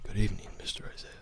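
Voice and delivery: whispered butler greeting; quick, hushed whisper of a butler. Shown as a demonstration. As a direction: try the butler as a fast, soft whisper. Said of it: "Now it's kind of like".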